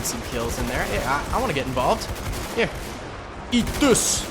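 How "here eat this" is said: hammed-up, higher-pitched mock British accent; bad British accent. Joining the Redcoats in a game for Queen and Country, bad British accent. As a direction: do a badly faked British geezer accent. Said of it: "I'm so bad at accents".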